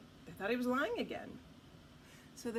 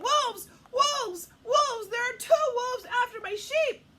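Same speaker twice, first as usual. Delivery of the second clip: high, loud cry for help; yelling alarm; 11 semitones higher, 11 dB louder than usual. A narrator's reading. Shepherd boy calling the townsfolk about wolves, yelling. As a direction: shout it out high, like raising the whole town. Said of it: "this time yelling".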